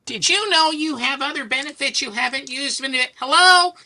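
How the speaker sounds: squeaky, high phone-pitch voice; mock caller in a piercing falsetto; telemarketer impression. Healthcare caller pitching unused benefits, mocked in high falsetto. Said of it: "Some healthcare thing".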